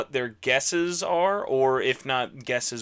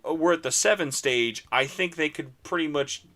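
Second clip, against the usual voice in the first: ordinary talk, nothing marked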